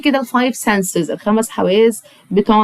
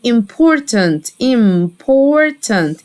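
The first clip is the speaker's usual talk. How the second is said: "important" drawn out syllable by syllable; slow pronunciation demo; much slower than usual. Shown as a demonstration. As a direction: stretch the middle of "important" for learners. Teacher models word slowly, then normally.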